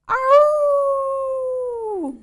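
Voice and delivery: very high, long drawn-out howl; wailing wolf pups. Acted. Wee wolfies wail back at their mother in a long high howl. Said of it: "wailed the five".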